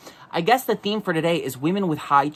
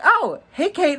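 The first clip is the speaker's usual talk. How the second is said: sassy high voice; 12 semitones higher than usual. Pretend. Mock court dismissing Kate.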